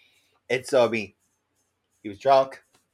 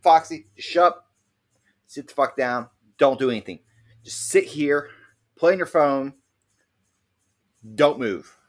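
louder, higher stern orders; firm scolding; 4 semitones higher, 6 dB louder than usual. Retold or imitated. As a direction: boss the drunk friend into sitting still. Ordering drunk friend to behave.

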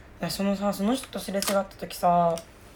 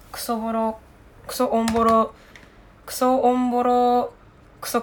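emphatic complaint; 4 semitones higher, 6 dB louder than usual. Real, not acted. Exaggerated complaint about old house.

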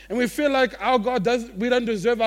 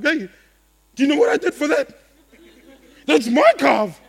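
indignant quote; 6 semitones higher, 10 dB louder than usual. Retold or imitated. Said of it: "we're like".

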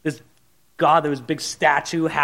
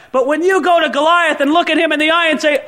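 ordinary delivery

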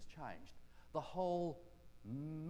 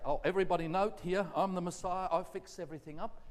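loud, raised-pitch showing off; mock boastful announcement; 3 semitones higher, 8 dB louder than usual. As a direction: proclaim yourself to the whole crowd, hammed-up. Mock boast Jesus did not make.

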